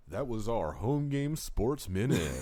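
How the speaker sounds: slow, loud sportscaster sign-off; announcer voice. Pretend. Closing the sports segment.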